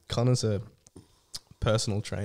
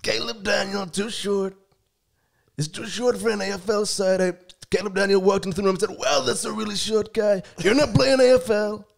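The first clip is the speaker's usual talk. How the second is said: put-on Donald Trump accent, much higher and a bit louder; Trump impression; 9 semitones higher, 3 dB louder than usual. Guest picking Bulldogs players on request, in a Trump voice. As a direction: bluster like Trump picking the team. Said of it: "About in the Trump voice?".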